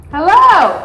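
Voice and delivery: high, loud drawn-out hello; effusive greeting. Real, not acted. Mother and daughter greet on the phone, effusive and loud. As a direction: greet a loved one in a big, ringing voice.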